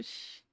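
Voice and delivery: very soft, drawn-out shush; hushing sound. Retold or imitated. Mother reacting to her daughter's account, hushed shush. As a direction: let out a barely voiced "shh".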